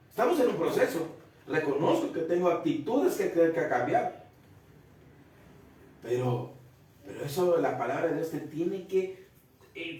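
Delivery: usual speaking voice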